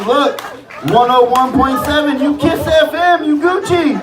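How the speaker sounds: high, slow, amused know-it-all buddy; stoned buddy's voice. Retold or imitated. Stoned buddy setting him straight on the radio, high voice. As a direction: pitch way up and lecture like the stoned friend. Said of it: "And he's like".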